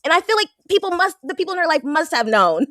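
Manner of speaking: pitched high and heated; rant, voice climbing; worked up. Real, not acted. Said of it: "You worked up today".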